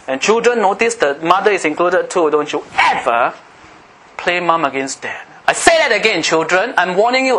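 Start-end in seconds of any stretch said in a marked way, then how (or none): 5.5-7.4 emphatic, higher-pitched preacher's warning; near-shouted admonition from the pulpit; raised-voice warning. Real, not acted. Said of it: "I'm sorry I'm shouting".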